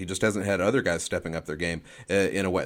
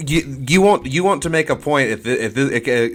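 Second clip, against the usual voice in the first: higher, louder, disbelieving challenge; louder and higher, calling out a co-host's flip-flop; incredulous; 6 semitones higher, 11 dB louder than usual. Real, not acted. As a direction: push back in disbelief.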